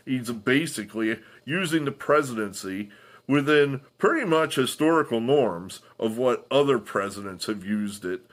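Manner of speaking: ordinary talk, nothing marked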